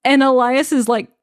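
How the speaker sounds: ordinary delivery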